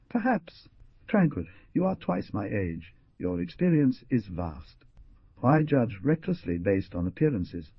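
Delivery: plain reading voice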